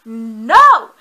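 sudden loud shout of no. Retold or imitated. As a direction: blurt it out, loud. Book character quarrel retold.

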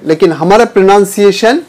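usual speaking voice

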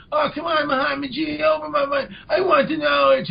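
high, pleading whine with a crying edge; whining Dennis. Retold or imitated. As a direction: beg the guru like a wounded child. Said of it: "Dennis is whining again".

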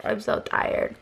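breathed out in a whisper; tired whisper. Real, not acted. Vlogger repeating her exhaustion to viewers, whispered. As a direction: let it fade to a weary whisper.

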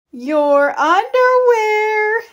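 high, slow, amused answer; mother laughing as she tells him; a drawn-out, giggling reveal. A narrator's reading. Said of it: "his mother laughed".

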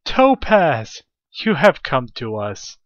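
high, loud and slow, hamming up the joy; theatrical rapture over finding a gem; overjoyed mock cheer. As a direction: greet the ore like a long-lost hero. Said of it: "We will be like".

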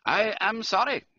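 high-pitched German count's regret; regretful. A narrator's reading. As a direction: offer sympathy in a German accent. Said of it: "clicked his tongue regretfully".

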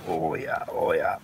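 low growl. Real, not acted. Savoring spicy steak.

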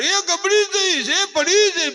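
very high, loud, slow alarmed cry; panicked family. Retold or imitated. Whole household crying out as the child nears the edge, high panicked shout. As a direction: shriek as if the child is falling. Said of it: "તા તો આખું ઘર".

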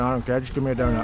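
quick, lightly voiced greedy toddler; speaking for the baby to tease him, fast and only lightly voiced; voicing the baby. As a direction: rattle it off like a kid who wants the donut now. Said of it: "You go".

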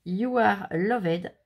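very slow English with a French accent; loud, slow English sticker text in a French accent. Real, not acted. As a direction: read the English words slowly and carefully, French accent. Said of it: "je vais commencer à redire des bêtises".

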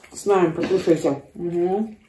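chewing pancake while talking; muffled by food; mouth full. Real, not acted. Seen 0: adult bit pancake earlier, jaw working, mouth partly full while speaking.

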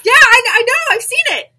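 high, loud, eager voice; hammed-up, bubbly movie-scene reply; chirpy cartoon fish. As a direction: blurt it like an excitable cartoon helper. Acting out animated film scene.

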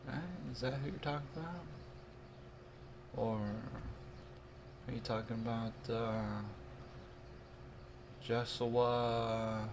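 ordinary delivery

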